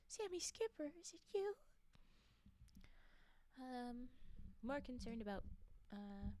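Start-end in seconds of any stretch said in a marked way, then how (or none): 0.1-1.5 playful calling. Pretend. Calling to the drawn character.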